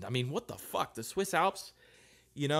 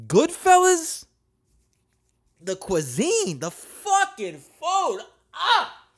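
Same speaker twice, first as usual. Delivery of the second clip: excited; 8 semitones higher, 5 dB louder, slower than usual. Real, not acted. Rapturous praise of Italian culture.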